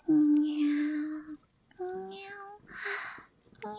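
three small catlike mews; cute meows. Acted. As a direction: mew like a shy kitten. Cat girl meowing.

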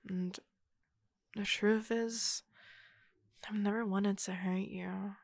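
usual speaking voice

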